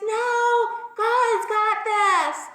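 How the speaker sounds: high, loud, drawn-out mock yell; shouted refusal. Man on the roof refusing helicopter, stubborn shouting. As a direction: yell it slowly, stretching each word.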